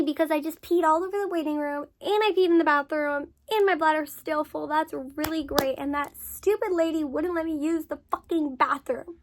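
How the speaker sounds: indignant rant. Real, not acted. Telling viewers about the nurse, loud indignant rant. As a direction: vent the outrage.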